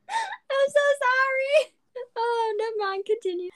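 giggling apology; laughing. Real, not acted. Laughs at own mistake.

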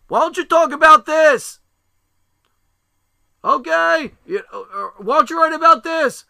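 high, full-volume shout; retold yelling. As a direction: re-enact a frantic dad yelling suggestions. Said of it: "everybody's yelling at me".

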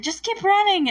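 sudden high urgent shout to run; urgent yell. Retold or imitated. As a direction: yell at your friend to keep going. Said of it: "Mike's like".

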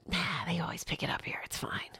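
hushed, dismissive whisper to herself; breathy reassurance to herself in a whisper; whispered self-talk. Retold or imitated. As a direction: whisper it off like it's nothing. Whispered inner voice, brushing off doubt.

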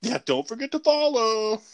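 high, hammy YouTuber plea. Retold or imitated. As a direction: ham up a cheesy outro. Mocking YouTuber outro.

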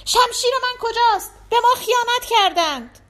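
high, loud cry of betrayal; alarmed shout. A narrator's reading. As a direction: shout it out high and forceful, rousing the house. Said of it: "فریاد کشید".

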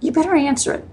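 a hint of the sister pressing her to pick up; imitating her sister. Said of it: "And she said".

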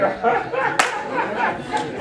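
high-pitched burst of laughter; real laugh. Real, not acted. Pastor laughing with the congregation, high laughter. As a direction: crack up at yourself. Laughs at his own slip.

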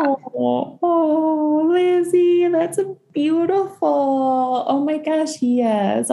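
high, drawn-out tender coo; touched, stretched-out high exclamation; deeply moved. Real, not acted. Moved by the client's story.

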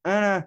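high, loud "uh" in place of words; loud wordless noise aimed at a dog. Shown as a demonstration. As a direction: mimic the tune of dog praise without words. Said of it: "make the noise with my mouth instead".

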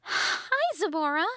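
very high, squeaky fairy voice; Tattle. A narrator's reading. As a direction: greet him in a tiny fairy pitch. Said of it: "Tattle said".

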